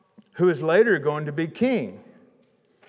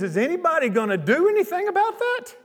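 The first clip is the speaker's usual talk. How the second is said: put-on indignant boy; 12 semitones higher, faster than usual. Boy David outraged at giant's taunts.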